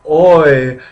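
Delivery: "Oi" called out high and loud as English students say it. Retold or imitated. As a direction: blurt it like a startled Brit. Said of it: "English people studying ancient Greek".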